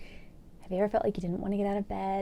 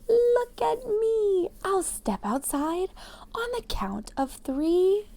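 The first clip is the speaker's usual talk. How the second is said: excited shout; 9 semitones higher, slower than usual. A narrator's reading. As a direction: shout it gleefully while twirling. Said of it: "He twirled and shouted".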